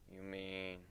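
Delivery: soft, low query; barely voiced; hushed question. Aoi quietly checking with his companion.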